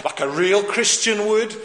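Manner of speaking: normal talking voice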